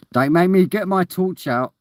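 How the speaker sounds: hammed-up warning; mock threat. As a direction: playfully threaten him. Joking threat to stranger.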